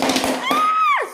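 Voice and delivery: very high piercing scream. Acted. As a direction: shriek as she falls off the track. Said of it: "screamed Mavis".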